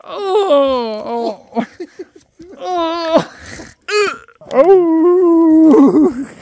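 laughing pained groans; just hit in the groin. Real, not acted.

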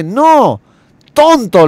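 high, loud exasperated cry; angry insult. Acted. Young man furious with the genie, shouting the insult. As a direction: yell the insult in frustration.

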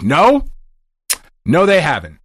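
curt annoyed no; clipped, annoyed answer; irritated reply. Retold or imitated. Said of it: "my tone by that".

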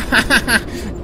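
ha-ha-ha chuckle; cheeky laugh. Real, not acted. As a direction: laugh at your own joke. Laughs at his own joke.

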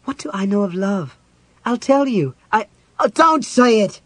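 rising to a high, loud outburst; losing control. A narrator's reading. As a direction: build from indignation into a shout. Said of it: "Completely losing control of himself".

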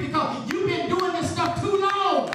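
loud, commanding declaration; Jesus delivering. Acted. Preacher voicing Jesus loudly telling the spirits he came to free them. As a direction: proclaim freedom with full force. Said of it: "Jesus said".

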